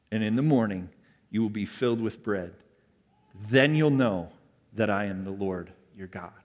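low, soft voice of God; solemn scripture reading. Acted. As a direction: read it as God speaking, hushed. Reads God's words from scripture.

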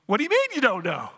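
quick, mildly exasperated comeback; lightly acted disbelief, hurried; baffled angel. Angel questioning the newly arrived thief, mildly baffled. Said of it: "The angel".

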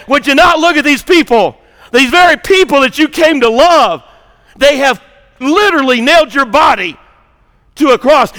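normal talking voice

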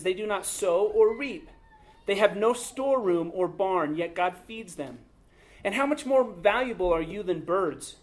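proclaiming scripture to the congregation; scripture reading. Acted. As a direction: read the passage out to the whole room. Reads Luke 12 aloud.

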